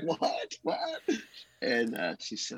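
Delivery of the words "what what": high, disbelieving double what; stunned what. Retold or imitated. Husband stunned when wife already knows his calling. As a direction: caught completely off guard, voice jumping up.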